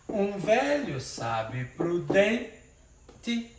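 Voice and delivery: drawn-out recited verse, syllable by syllable; slow poetic scansion. Shown as a demonstration. Teacher reciting the verse slowly for the class. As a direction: stretch each syllable like reciting a poem. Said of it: "a contagem é até a sílaba tônica".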